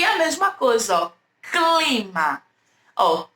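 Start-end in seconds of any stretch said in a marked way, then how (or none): 1.4-2.4 "clima" slow, with C and L each heard; C e L audíveis. Shown as a demonstration. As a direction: stretch "clima" to show both consonants. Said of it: "Eu pronuncio um pouquinho do C". ("clima" devagar, articulando o C e o L)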